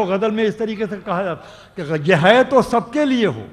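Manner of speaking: loud stage declamation of a couplet; ghazal recitation. Acted. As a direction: ring out the verse to the hall. Poet recites verse at mushaira.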